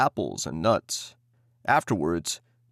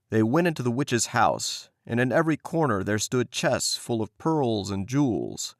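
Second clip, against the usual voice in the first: ordinary delivery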